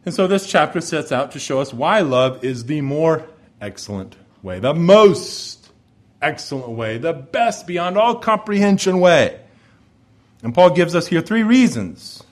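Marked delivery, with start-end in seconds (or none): none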